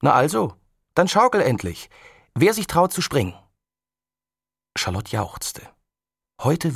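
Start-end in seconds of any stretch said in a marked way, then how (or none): 0.0-3.3 put-on girl's higher, louder dare; mild excited girl; daring call. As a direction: egg him on to jump. Girl dares boy.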